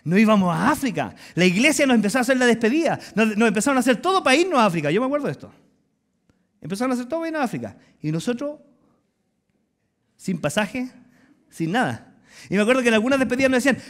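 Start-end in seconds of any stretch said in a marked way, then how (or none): none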